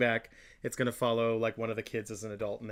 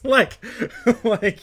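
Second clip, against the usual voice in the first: high, loud laughing; 10 semitones higher, 10 dB louder than usual. Real, not acted. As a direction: laugh through the words. Laughing fit.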